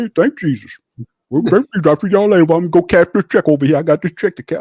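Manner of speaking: high breezy mock voice; mock pious Carr. Mocking Carr thanking Jesus at the presser, high smug voice.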